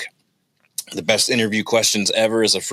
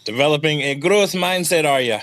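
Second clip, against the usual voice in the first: higher, jokey Irish brogue; mock Irish accent; 6 semitones higher than usual. Host teasing his co-host in a mock Irish accent. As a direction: tease in a light Irish lilt. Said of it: "without the Irish accent or Scottish or whatever that was".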